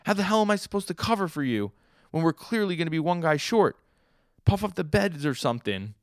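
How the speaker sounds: very high, loud panicked protest; freaking out. Retold or imitated. Cellmate told to cover for Sasuke, freaking out. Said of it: "like, freaks out".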